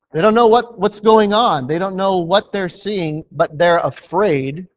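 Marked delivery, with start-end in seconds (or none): none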